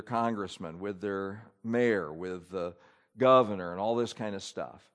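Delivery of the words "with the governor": ordinary talk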